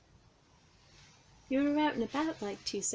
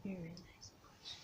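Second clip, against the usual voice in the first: coaxing whisper; 16 dB quieter than usual. Real, not acted. Whispering to petted cat again.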